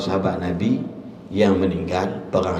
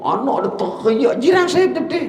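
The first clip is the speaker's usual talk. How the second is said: high mock voice; 13 semitones higher than usual. Neighbour's child crying, retold.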